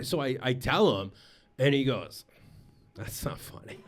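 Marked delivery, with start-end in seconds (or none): 3.0-3.8 hushed, near-whispered imitation of a small critic; imitated quiet verdict. Four-year-old son judging dad's joke, imitated in a hushed murmur. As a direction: drop to a low murmur, imitating a child's judgment.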